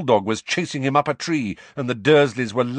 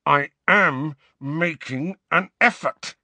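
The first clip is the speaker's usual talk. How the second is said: slow, raised, seething retort; through clenched teeth; 6 semitones higher, 4 dB louder, slower than usual. A narrator's reading. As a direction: force each word through clenched teeth. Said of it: "he said through clenched teeth".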